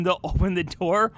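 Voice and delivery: ordinary talk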